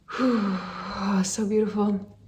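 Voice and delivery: breathless whispered awe; hushed, reverent whisper; awed whisper. Real, not acted. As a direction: whisper it as if overwhelmed by beauty. Overwhelmed by the vision.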